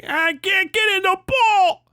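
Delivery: high, slow whiny cartoon voice; high drawn-out cartoon whine; F is for Family cartoon voice. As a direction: whine it like a cartoon kid stuck outside the pool. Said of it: "like a F for Family bit from Bill Burr's cartoon".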